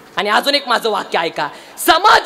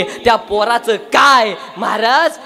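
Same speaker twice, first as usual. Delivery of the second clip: loud, slow, lamenting kirtan delivery; preaching lament; 3 semitones higher, 4 dB louder, slower than usual. Acted. Preacher lamenting the ruined family to the crowd, slow and loud. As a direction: mourn the loss before the crowd.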